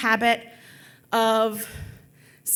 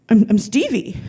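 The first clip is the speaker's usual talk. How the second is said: dumb thug voice; 4 dB louder than usual. Retold or imitated. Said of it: "it's my dumb thug voice".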